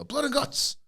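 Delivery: high-pitched mock shout; imitating a shouted announcement; retold shout. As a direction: call it out high without real volume. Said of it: "They shouted out on the collision".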